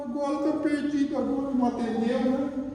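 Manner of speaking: put-on high, whimpering child's voice; crying boy. Boy fears they're lost in the snow, crying. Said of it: "começou a chorar".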